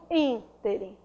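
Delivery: "ínterim" with a stressed opening ÍN; stress on first syllable. Shown as a demonstration. Teacher pointing out the tonic syllable, "ínterim" stressed on ÍN. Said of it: "a sílaba tônica dela é a antepenúltima".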